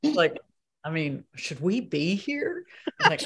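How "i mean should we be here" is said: slow, lightly imitated colleague; Margot Martindale impression. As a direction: draw out the question as a light impression. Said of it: "Very good impression".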